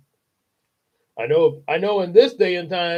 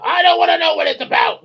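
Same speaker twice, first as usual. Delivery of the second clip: high loud fast bark; father cutting his daughter off with a bark; 13 semitones higher, 8 dB louder, faster than usual. Acted. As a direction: snap it out angrily. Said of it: "Mister Wormwood barked".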